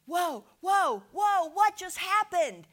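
high, loud burst of amazement; acted astonishment. Preacher voices heaven's reaction to the Lamb, loudly astonished. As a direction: exclaim it in stunned surprise, drawing it out. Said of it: "and so it's like".

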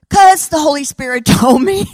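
half-laughing confession. Retold or imitated. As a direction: giggle as you admit it. Punchline of her own story.

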